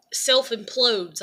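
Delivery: ordinary delivery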